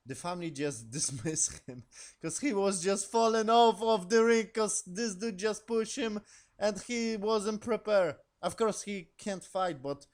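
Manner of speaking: can't stop laughing at the story; laughing through it. Real, not acted. Laughing while retelling the push.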